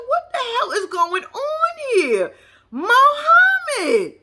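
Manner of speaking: high, slow, shouted shock; shocked outcry. Real, not acted. Vlogger reacting to Muhammad's words with a high shouted outcry. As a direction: cry out in disbelief.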